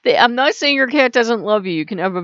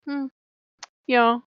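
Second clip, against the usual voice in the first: indifferent cat; 4 semitones higher than usual. Retold or imitated. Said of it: "A cat's like".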